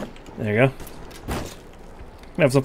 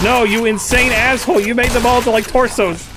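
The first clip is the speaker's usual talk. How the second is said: high-pitched, exasperated scolding; exasperated outburst; 6 semitones higher than usual. Real, not acted. Gamer scolding whoever made the torsos, exasperated. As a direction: protest in raised, incredulous pitch. Mock outrage at game character.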